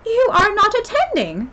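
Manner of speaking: high, loud scolding Mouse; stern telling-off at full voice; severe. A narrator's reading. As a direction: scold sharply, pitched right up. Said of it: "said the Mouse to Alice severely".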